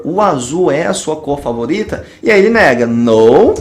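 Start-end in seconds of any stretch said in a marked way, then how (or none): none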